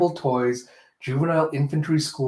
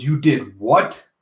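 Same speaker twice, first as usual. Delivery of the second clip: drawn-out, louder menace; slow menacing disbelief; 4 dB louder, much slower than usual. Acted. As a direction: lean in and drag out the question. Zazie grabs Alita by collar.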